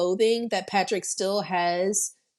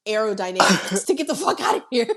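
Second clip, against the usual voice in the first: hurried panic; 3 dB louder, faster than usual. Retold or imitated. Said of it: "you're just like".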